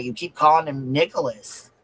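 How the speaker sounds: ordinary talk, nothing marked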